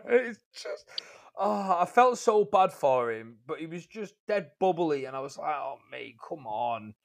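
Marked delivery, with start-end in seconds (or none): 5.5-6.9 soft, worn-out appeal; weary plea. Retold or imitated. Tired driver begging bubbly customer to ease off, weary. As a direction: let it drop away quietly, fed up.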